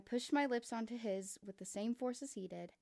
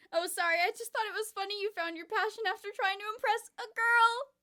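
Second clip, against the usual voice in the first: higher and louder, laughing through it; mocking giggly Kokichi; 12 semitones higher, 10 dB louder than usual. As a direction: tease him and burst into giggles. Kokichi mocks, then laughs.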